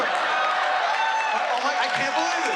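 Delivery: shrieking high gasp of disbelief; mock horror. Comic seeing himself in the mirror, high shocked squeal. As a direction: recoil at your own body in the mirror, squealing. Horrified at his own mirror body.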